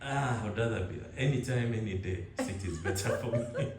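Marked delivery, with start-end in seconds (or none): none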